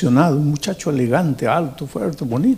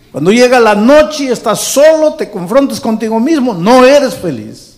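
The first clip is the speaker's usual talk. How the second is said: rising, louder impassioned preaching; preaching at full force; 4 semitones higher, 3 dB louder than usual. Acted. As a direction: preach it out with growing fire. Preacher building to climax.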